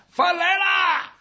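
high, drawn-out call from outside. A narrator's reading. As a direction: holler that food is here, stretching it slowly. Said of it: "就听外边有人喊". (高声拖长吆喝)

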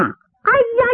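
high, drawn-out "aiya"; mock scream of a man struck; agonised cry. Liu Rubao hit from behind, high cry of agony. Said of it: "一声惨叫".

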